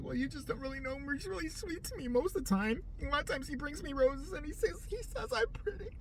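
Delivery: soft, high, pleading meek voice; hushed high mock battered wife. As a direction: play the coach as a timid abused wife. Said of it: "the coach sounded like a battered wife".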